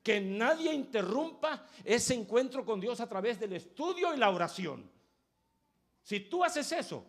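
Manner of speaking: high, loud pulpit cry; fiery preaching. Acted. Preacher crying out to the congregation, fiery and loud.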